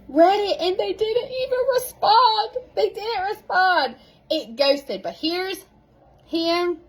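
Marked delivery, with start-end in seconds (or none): 0.1-3.9 high wailing fake sob over being ignored; mock crying. Coach to her team, hamming up a mock cry over being ghosted. Ghosted by people she asked.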